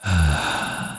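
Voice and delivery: soft sigh of relief; relieved sigh. Acted. Vampire diplomat told the farmer will shelter them, relieved sigh. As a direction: let out a quiet sigh, relieved. Farmer agrees after pleading.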